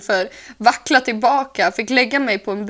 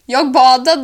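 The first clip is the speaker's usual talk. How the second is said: proud announcement; 3 semitones higher, 9 dB louder, slower than usual. Real, not acted.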